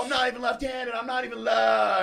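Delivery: high-pitched re-enacted yell; screaming. Repeating his boast to campus police, screaming. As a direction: keep yelling it high, cut off mid-word. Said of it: "Now I start screaming".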